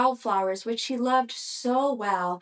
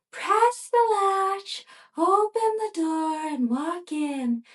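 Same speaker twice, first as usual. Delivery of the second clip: high, slow grandmother inviting; 8 semitones higher, slower than usual. A narrator's reading. Grandmother tells the visitor to come in, high and slow. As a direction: call kindly from the bed, high and slow.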